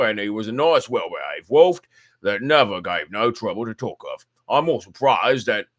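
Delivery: put-on old keeper talking shop over his pipe, loud and blunt; gruff old zookeeper. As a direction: boom it out like a blunt working man. Keeper speaks in dialect.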